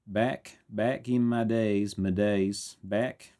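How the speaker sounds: very slow southern drawl; exaggerated, drawn-out southern accent. Shown as a demonstration. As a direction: try on a southern accent, dragging each word. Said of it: "with a southern accent".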